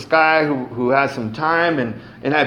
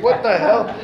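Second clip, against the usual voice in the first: laughing disbelief; 7 semitones higher than usual. Retold or imitated. Amazed laughing at invitation to go get loaded.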